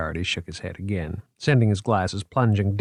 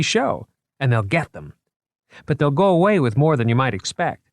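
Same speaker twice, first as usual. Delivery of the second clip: put-on higher, lively, eager young curator; proud museum man showing off his finds; 5 semitones higher than usual. Moriarty's character voice.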